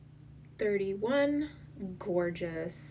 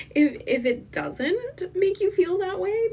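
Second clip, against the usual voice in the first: giggly burst; 12 semitones higher, 9 dB louder than usual. Real, not acted. Giggling defiance at viewers.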